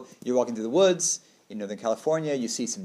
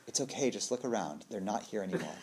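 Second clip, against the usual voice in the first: soft, lowered comforting voice; mock reassurance; 4 semitones lower, 9 dB quieter than usual. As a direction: quietly soothe a worried friend, playfully. One deer reassuring another, acted.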